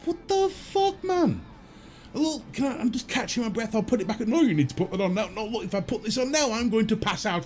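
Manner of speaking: high-pitched, indignant pleading; exasperated protest. Retold or imitated. Patient out of breath argues about the mask, exasperated protest. Acted argument over removing his mask.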